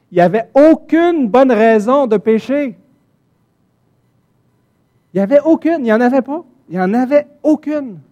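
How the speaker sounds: high, loud, insistent preacher's cry; fiery preaching. Acted. Preacher pressing the congregation about Adam and Eve, loud and high. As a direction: hammer home that sin had no reason. Preacher rising to full force.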